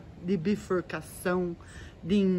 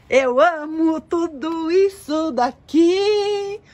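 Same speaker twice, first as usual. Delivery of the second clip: high, loud, slow burst of delight; delighted gush; 8 semitones higher, 9 dB louder, slower than usual. Real, not acted. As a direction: gush over the plants. Delighted gushing over her plants.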